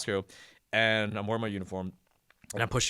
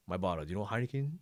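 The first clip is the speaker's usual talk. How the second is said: lightly imitated Pidgin, Samoan accent; butchered Samoan accent; 7 dB quieter than usual. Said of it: "I'm gonna butcher the accent".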